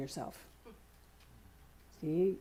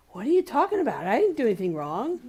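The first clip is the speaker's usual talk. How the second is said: lightly voiced puzzled animal, higher and louder; confused dog; 4 semitones higher, 6 dB louder than usual. Owner voicing her scolded dog, mildly indignant and puzzled. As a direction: play the dog protesting its innocence. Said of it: "it like".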